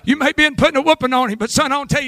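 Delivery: rising, shouted revival preaching; preaching at full force. Acted. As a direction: drive the congregation with a loud, building sermon.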